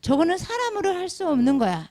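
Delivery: hammed-up onlooker marvelling at healing; mock astonished. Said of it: "이렇게 생각을 하는데".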